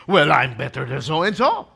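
a hint of a proud rival answering back; smug retort. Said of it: "the so-and-so says".

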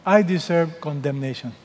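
ordinary talk, nothing marked

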